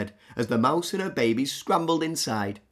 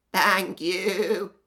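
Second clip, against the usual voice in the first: grateful goat; 6 semitones higher, 6 dB louder than usual. Acted. Goat's voice.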